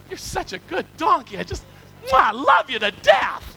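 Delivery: hammed-up doting, high-pitched, swelling to a loud finish; lavish exaggerated love, ending in a squeal; gushing affection. Balaam praising the donkey that saved him, gushing mock affection.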